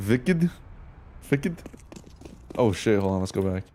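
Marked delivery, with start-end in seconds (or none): 0.1-1.6 put-on Wicked said as Vicked, German accent, questioning rise; playful German accent with a hard V on Wicked; mock German accent. Streamer reads the viewer's team name, mock German accent. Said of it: "Had to say in a German accent".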